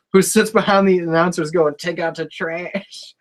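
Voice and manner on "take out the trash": soft, slow, eerie mutter; weird quiet voice. Retold or imitated. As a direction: murmur it like a mystery fan behind the desk. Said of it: "this traveling fan".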